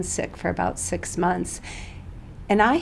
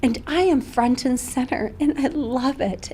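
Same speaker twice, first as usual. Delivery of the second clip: getting emotional; 5 semitones higher than usual. Real, not acted. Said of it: "I'll get emotional on this".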